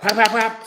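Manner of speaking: quick, high whap sounds; imitated smacks. Telling how grandma smacked the twins, punchy imitated whaps. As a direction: act out a flurry of slaps with the voice.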